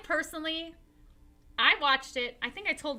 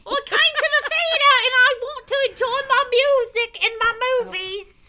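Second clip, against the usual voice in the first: high, loud hammed-up Cockney; Cockney accent; 11 semitones higher, 7 dB louder than usual. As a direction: ham up a Cockney complainer. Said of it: "That's my best Cockney accent".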